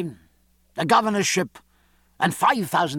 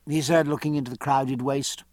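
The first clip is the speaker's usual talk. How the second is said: ordinary delivery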